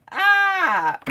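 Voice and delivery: mock scared cry. Scared of horror movies.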